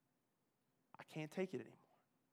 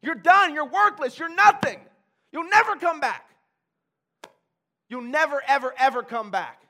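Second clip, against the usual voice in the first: much higher, much louder taunting yell; Satan on his shoulder taunting him; Satan's voice; 8 semitones higher, 13 dB louder than usual. Retold or imitated. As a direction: sneer and scream it like the devil. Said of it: "I got Satan over here like".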